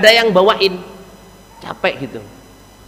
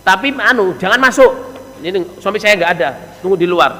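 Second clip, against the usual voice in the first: bar the visitor curtly; acted inhospitable wife, quick and pitched up; rude wife; 2 semitones higher than usual. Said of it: "ngomongnya gitu".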